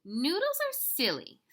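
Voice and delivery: lightly voiced high little girl; a hint of childish dismissal; little sister. Second little sister chimes in.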